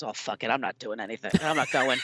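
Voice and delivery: low, soft mock Bill Murray grumble building to a shout; Bill Murray impression. To the guest, Bill Murray refusing, low then shouted. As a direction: shrug it off, then blurt the last line.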